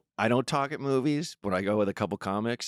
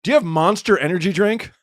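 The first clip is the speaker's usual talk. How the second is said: higher, louder, eager asking; eager high question; 7 semitones higher, 6 dB louder than usual. Retold or imitated. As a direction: ask like a clueless bar customer. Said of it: "just walks in and just goes".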